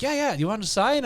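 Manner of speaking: high-pitched mock salesman. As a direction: pitch it high, like a door-to-door seller.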